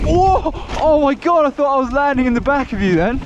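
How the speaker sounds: high, loud thrilled yelling; excited shouting. Real, not acted. Near collision on night ride.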